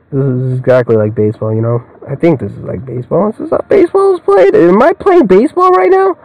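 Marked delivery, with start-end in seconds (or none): 3.3-6.1 much higher silly squeak; mock high voice. Real, not acted. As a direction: ask it in a goofy high voice.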